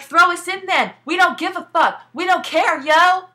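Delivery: high, loud defiant yell; mock angry outburst. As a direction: shout it daring them to do it. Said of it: "she gets pissed and she's like".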